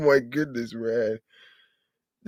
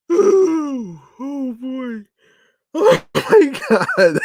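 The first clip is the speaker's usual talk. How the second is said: laughing hard; 12 semitones higher, 4 dB louder, much slower than usual. Real, not acted. Vlogger still cracking up at the menu, high and laughing. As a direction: squeak it out between laughs.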